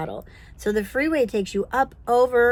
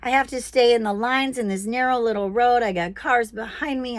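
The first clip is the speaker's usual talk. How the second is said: normal talking voice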